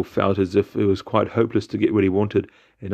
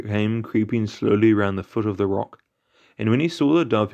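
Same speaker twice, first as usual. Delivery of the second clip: ordinary delivery, nothing marked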